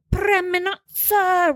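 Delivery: high, drawn-out hammed-up Italian accent; mock Italian accent. Mimicking commentator saying team name, mock Italian accent. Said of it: "in a weird Italian accent".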